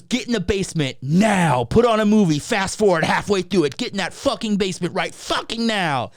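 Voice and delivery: high, loud, urgent mock orders; hammed-up furious mom; angry mom impression. Mom rushing boys into the basement, angry.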